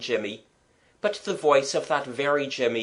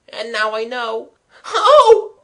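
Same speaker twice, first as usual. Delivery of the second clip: much higher, voice cracking into a loud cry of fear; breaking into panic; 10 semitones higher, 11 dB louder than usual. Acted. Trapped boy to his brother, panic breaking through into a cry. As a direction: let the fear burst out on the last word.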